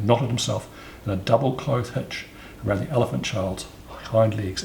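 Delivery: usual speaking voice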